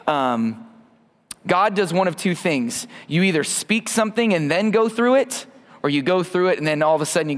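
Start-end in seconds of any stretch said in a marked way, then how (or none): none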